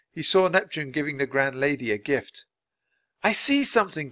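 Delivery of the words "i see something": high-pitched exclamation; eager voice, pitched up; excited cry. A narrator's reading. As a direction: cry out at a sudden sighting. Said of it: "cried Annie".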